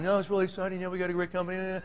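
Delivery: flat, droning sales pitch with no pitch change; monotone voice. Retold or imitated. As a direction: drone it out in one even tone. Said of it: "just monotone".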